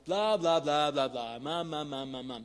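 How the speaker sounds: lightly chanted babble of meaningless syllables; mock baby talk. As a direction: babble mockingly, syllables run together. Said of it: "That's just baby talk".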